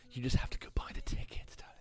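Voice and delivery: hushed, breathy sales whisper. Acted. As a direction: lean in and whisper the secret. Sly aside to fans, selling tickets.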